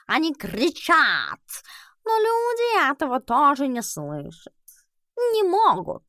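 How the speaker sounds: slow, high drawling cat. Acted. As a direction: stretch every word like a lazy cat. Albus the cat explains.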